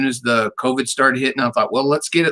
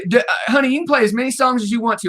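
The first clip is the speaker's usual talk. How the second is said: excited; 10 semitones higher, much faster than usual. Real, not acted. Host eagerly telling the singer to play as many songs as she wants, high excited shout. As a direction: cheer her on, pitch way up.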